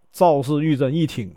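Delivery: plain reading voice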